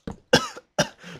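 short put-on coughing burst; fake choking cough. Player in pretend poison gas, put-on coughing. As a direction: cough as if gassed, hammed-up.

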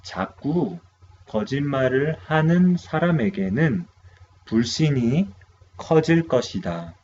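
quick teacher reading of the Korean sentence; brisk model read of 거짓말을 하는 사람에게는; fast model sentence. Shown as a demonstration. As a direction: run the sentence off fast for students to repeat.

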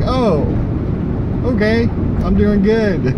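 high, sugary, overly polite voice; mock polite reply. Answering the salon lady, a mock cheery high reply.

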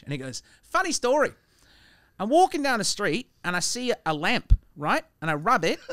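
put-on Australian joke-telling voice; bad Australian accent. Comic telling a bartender street joke, put-on Australian accent. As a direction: tell it in a loose Australian accent. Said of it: "it has to be done in an Australian accent".

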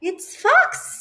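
high squeaky announcement; bright mouse squeak. Acted. Mouse names Fox in a high squeak. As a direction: chirp it brightly.

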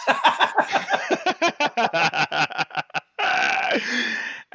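high loud laughing fit; loud burst of laughter. Real, not acted. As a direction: break into a big laugh. Laughing at newbies' question.

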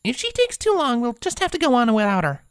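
high voice, forced calm; steady and composed despite nerves; Twilight, composed. A narrator's reading. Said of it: "with a surprising amount of composure".

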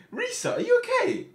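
usual speaking voice

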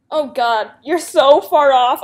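loud, slow exasperated jab; loud slow teasing complaint. Real, not acted. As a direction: drag it out in disbelief at the bad guess. Mocking the Akinator's bad guess.

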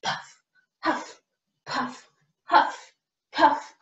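short breathy puffs; playful out-of-breath chant; pretend huffing and puffing. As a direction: puff out each word as if running. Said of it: "let's huff and puff and run".

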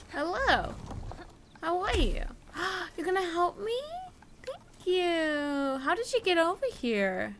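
high sweet voice for the golem; cutesy voice. Acted. Gamer greeting the little golem in a high cutesy voice. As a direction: talk to it like a cute pet.

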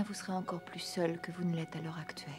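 whispering. Acted. Tender warning to Phileas.

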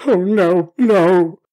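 desperate plea. Acted. Scrooge begging the spirit, desperate and loud. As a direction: plead loudly in dread.

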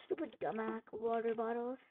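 usual speaking voice

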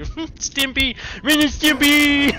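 excited laughing shout. Real, not acted. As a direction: blurt it out through laughter. Laughing, recognising the cartoon name.

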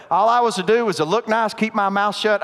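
ordinary talk

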